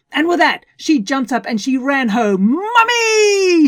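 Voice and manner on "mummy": high, loud call for mum; bellowing. Acted. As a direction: bellow across the house for mum. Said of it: "she bellowed".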